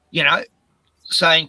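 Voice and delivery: ordinary delivery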